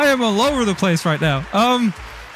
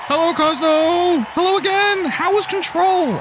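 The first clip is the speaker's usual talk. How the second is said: cheery greeting; 8 semitones higher, slower than usual. Real, not acted. Greeting a raider.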